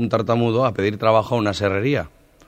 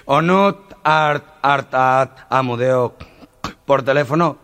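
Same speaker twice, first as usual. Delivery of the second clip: stutterer; 3 semitones higher, slower than usual. Retold or imitated. Stutterer saying he sings on the phone, stammering. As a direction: stammer out the explanation. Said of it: "el tartamudo".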